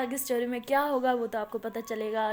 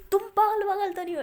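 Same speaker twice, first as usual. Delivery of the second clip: fast, louder angry throw-back of "पागल वागल"; angry retort; 7 semitones higher, 6 dB louder, faster than usual. Acted. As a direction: snap the words back at her, higher and quicker. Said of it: "तो माया को गुस्सा आ जाता है".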